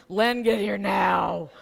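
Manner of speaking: slow, loud, panicked call down the phone; panicked shout. Retold or imitated. Frightened wife yelling down the phone to Len, slow and loud. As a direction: yell it out slowly in a panic. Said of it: "I'm going".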